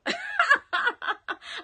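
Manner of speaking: very loud, high belly laugh; real shrieking laughter; cracking up. Real, not acted. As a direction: let out another big high laugh. Still cracking up with viewer.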